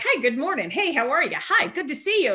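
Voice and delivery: high, swooping sing-song hellos; eager greetings. Retold or imitated. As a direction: greet every stranger like a naive newcomer. Naive newcomer greeting New York crowds.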